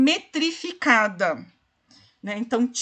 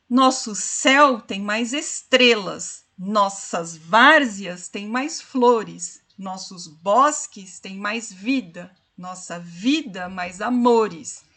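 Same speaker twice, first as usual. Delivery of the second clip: slow, measured reading that marks every beat; rhythmic poem recital; 2 semitones lower, 3 dB louder, slower than usual. Shown as a demonstration. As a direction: scan the verse syllable by syllable, unhurried. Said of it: "o que dá a musicalidade do poema".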